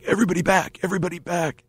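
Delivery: high-pitched yell, held back in volume; mock urgent yell; retold yelling. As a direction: imitate a worker clearing the crowd. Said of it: "just starts yelling".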